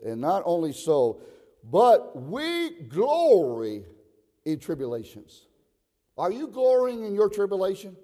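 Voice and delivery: loud, high, drawn-out preaching; fervent pulpit proclamation; declaimed scripture. Real, not acted. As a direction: stretch each key word and ring it out. Scripture declaimed at full force.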